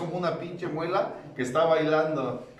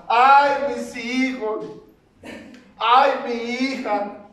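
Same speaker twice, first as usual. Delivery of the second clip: slow, high, loud mock wailing; Llorona wail; 9 semitones higher, 7 dB louder, much slower than usual. Mocking a father crying in the stands, slow high Llorona wail. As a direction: stretch it out like a weeping ghost, hammed up. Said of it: "como la Llorona".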